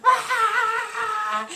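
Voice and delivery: put-on little girl's high panicked shriek; frightened scream. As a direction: shriek as if slipping off the log. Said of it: "starts to freak out 'cause she's like".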